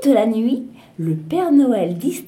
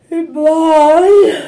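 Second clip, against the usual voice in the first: yawning; 7 semitones higher, 10 dB louder than usual. Acted. Narrator mimics reindeer yawning.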